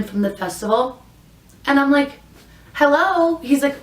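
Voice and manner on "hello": indignant hello. Retold or imitated. Calling out to the wandering driver.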